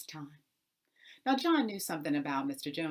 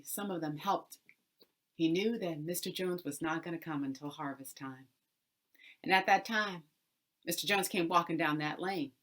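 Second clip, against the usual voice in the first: plain reading voice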